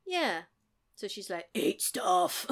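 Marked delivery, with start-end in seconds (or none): 1.5-2.5 unvoiced, rasped croak; hammed-up husky advert voice; sore-throat husky voice. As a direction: imitate a singer with a sore throat. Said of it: "she's got a really sore throat".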